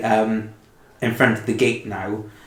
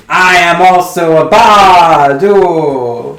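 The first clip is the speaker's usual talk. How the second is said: loud, high, slow proclamation; grand announcement; 6 semitones higher, 9 dB louder, much slower than usual. Acted. Bard announcing himself.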